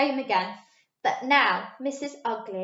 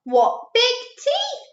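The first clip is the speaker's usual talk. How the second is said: put-on little girl; 13 semitones higher, 6 dB louder, slower than usual. A narrator's reading.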